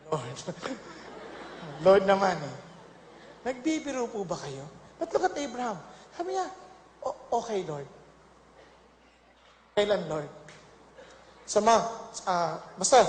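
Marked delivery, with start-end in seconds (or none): 0.1-4.6 quiet pleading. Acted. Abraham pleading. 7.0-10.3 quiet, resigned. Acted. Abraham submitting.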